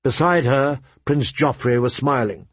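usual speaking voice

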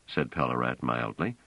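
ordinary delivery

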